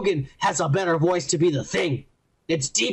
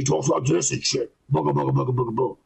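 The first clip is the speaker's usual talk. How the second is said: low, put-on character voice; lowered voice, babbling in imitation; imitating how he talks; 6 semitones lower than usual. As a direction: drop down into a mocking impression. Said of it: "That's the way he talks".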